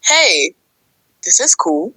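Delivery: ordinary talk, nothing marked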